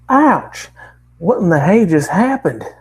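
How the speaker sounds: high, loud southern drawl; a startled country girl, southern accent; yelped in a southern accent. Acted. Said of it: "strong yet comprehensible southern accent".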